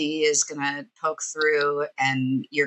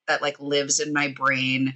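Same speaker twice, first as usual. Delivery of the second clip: ordinary delivery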